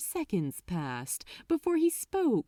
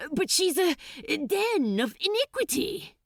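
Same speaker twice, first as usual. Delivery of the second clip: high, louder desperate plea; 10 semitones higher, 3 dB louder than usual. Acted. Crowley desperately arguing with the archdemon, high plea. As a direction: grab at straws. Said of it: "said Crowley desperately".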